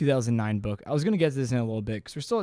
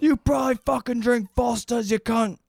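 high-pitched Australian abuse; mock Australian accent; 10 semitones higher than usual. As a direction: hurl insults as an Australian heckler. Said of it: "Any Australian listeners".

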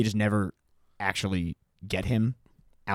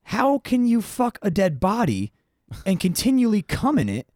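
incredulous; 11 semitones higher than usual. Real, not acted. Incredulous rant about Bundy.

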